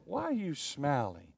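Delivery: soft, slow, baffled question; puzzled annoyance. Retold or imitated. A preacher voicing someone chewing out a smiler, soft and slow. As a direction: ask it low and slow, mystified. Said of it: "You go".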